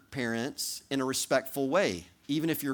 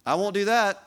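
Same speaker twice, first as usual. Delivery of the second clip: put-on higher, louder defiant kid; petulant child refusing; 6 semitones higher, 6 dB louder than usual. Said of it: "here's the response that I get".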